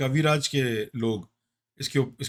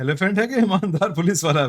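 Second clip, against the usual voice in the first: louder, faster repeat; 5 semitones higher, 6 dB louder, much faster than usual. Real, not acted. Co-host repeats the joke over the host, louder and faster. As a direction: push the joke again, louder and quicker.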